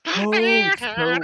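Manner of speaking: normal talking voice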